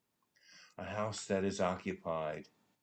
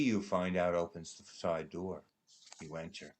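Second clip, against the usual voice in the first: usual speaking voice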